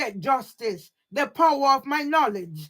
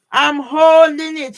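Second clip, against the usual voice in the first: holding punched, much louder; insistent loud; 3 semitones higher, 10 dB louder than usual. Real, not acted. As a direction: hammer home the word holding. Confronting Garfield, repeats line louder.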